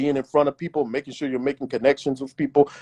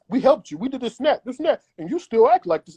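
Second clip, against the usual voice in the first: higher, exasperated mock reproach; fed-up followers; 8 semitones higher than usual. Followers turning on a complainer, higher and exasperated. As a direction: throw it back at them, fed up.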